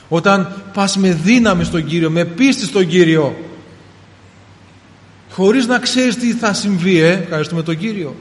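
full-force sermon voice; fervent preaching. Acted. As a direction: preach it with rising fire. Preacher's climax.

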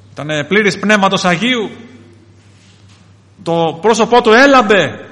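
loud, high proclamation; ringing pulpit declaration; impassioned preaching. Real, not acted. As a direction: exalt the martyr at full voice. Preacher exalts the martyr.

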